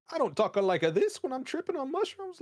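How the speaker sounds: high, loud cartoon Italian accent; mock Italian accent. Host imagines Mario tripping on mushrooms, hammed-up Italian accent. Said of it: "you're not Italian at all".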